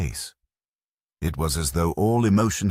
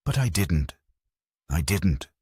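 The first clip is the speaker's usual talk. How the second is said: helplessly. A narrator's reading. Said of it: "She said helplessly".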